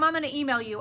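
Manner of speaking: ordinary talk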